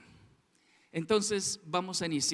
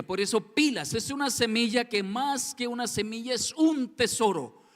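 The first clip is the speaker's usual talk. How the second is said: fervent sermon, raised voice; preaching; 4 semitones higher, 5 dB louder than usual. Acted. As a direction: preach it out to the congregation. Preaching at full force. (predicando con fervor)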